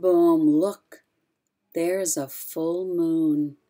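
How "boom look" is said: loud boom. Acted.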